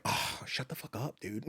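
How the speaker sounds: breathy half-whispered annoyed aside; unvoiced mock annoyance at the dog. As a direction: hiss it under your breath, slowly. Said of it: "it's like".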